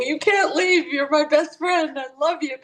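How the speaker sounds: high pleading voice, imitating the distraught sister; aunt begging her sister to stay, high and pleading. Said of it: "her sister was kind of freaking out".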